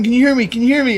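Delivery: usual speaking voice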